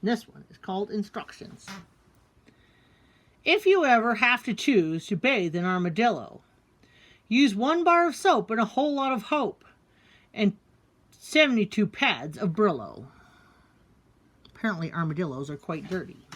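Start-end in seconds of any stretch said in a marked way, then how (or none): 3.4-13.1 rhythmic instruction poem; poem reading. A narrator's reading. Reading the armadillo poem aloud, rhythmic poem read. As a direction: read it like funny directions.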